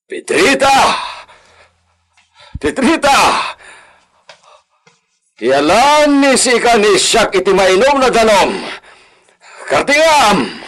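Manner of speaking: loud, high shout across the house; calling out. Acted. Sick master shouting for his servant to bring water. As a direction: yell for her to come at once.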